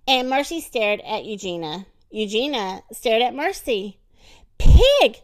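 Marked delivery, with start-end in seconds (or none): 4.6-5.2 high, very loud outburst; angry shout. A narrator's reading. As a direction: yell it as an accusation. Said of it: "shouted Eugenia".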